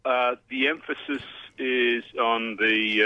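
ordinary talk, nothing marked

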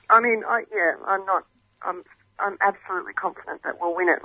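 ordinary delivery

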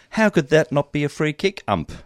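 mild puzzled appeal; questioning. Retold or imitated. Player questioning the umpire, mild puzzlement. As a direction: ask it as an honest question, lightly acted. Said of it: "an expression of questioning".

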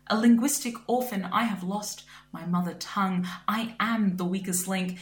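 ordinary talk, nothing marked

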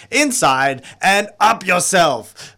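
drawn-out, loud declamation; big slow stage delivery; declaimed. Acted. Performer declaims the show's key line.

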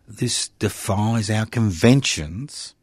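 slow, drawn-out officialese from the committee; pompous committee voice. Retold or imitated. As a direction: intone it like a stuffy parliamentary committee. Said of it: "They'll say".

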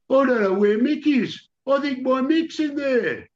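high-pitched wail of a frantic father. Acted. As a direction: panic over your missing child, high-pitched. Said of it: "drunk and frantic".

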